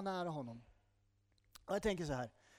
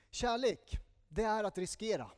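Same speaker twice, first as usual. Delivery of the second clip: "Kärlek" thrown out loud and higher, then settling; emphatic statement; 4 semitones higher, 5 dB louder than usual. Real, not acted. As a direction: hit "Kärlek" hard, then land the line. Preacher's punchline about love. (kraftfull betoning på "Kärlek")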